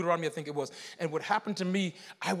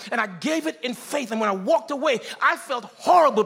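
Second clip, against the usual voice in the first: full-force preaching, pitched high; 6 semitones higher, 6 dB louder than usual. Real, not acted. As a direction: build into loud, impassioned sermon delivery.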